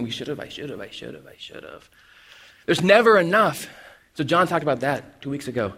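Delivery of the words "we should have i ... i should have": trailing down into a whisper on I should have; mock guilt dying away to a whisper; fading whisper. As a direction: fade each I should have to a breath. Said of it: "constant state of shoulding".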